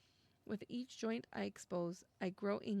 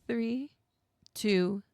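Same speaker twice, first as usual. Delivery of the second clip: louder, bracing countdown; loud nervous count; 11 dB louder, much slower than usual. Real, not acted. Gamer bracing to touch a cursed hand, loud count. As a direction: count down steeling yourself. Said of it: "I'm scared".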